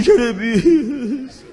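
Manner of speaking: drawn-out, slow, crying call of the name; tearful. Retold or imitated. Friend hugging Jeremias, slow tearful call. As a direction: call his name through tears. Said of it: "o outro disse assim".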